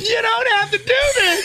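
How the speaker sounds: high-pitched mock begging; pleading. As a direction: copy a terrified man's wail. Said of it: "Say it like that".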